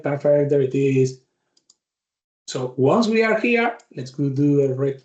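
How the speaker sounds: ordinary talk, nothing marked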